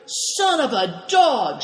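high, drawn-out angry cry; high-pitched furious oath, moderately loud; shouted curse. A narrator's reading. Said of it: "shouted Greencoat".

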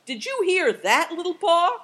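high, loud, booming question; thundering. A narrator's reading. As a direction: bellow it to a friend out of sight. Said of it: "he thundered".

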